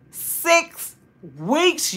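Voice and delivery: "Six weeks" pitched high, each word set apart by a pause; incredulous emphasis. Real, not acted. Telling viewers how long without a video, incredulous emphasis.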